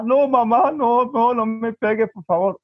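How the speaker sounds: imitated child's anxious begging; frightened pleading. Son begs mother not to hit him, acted frightened plea. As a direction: plead quickly as a scared boy.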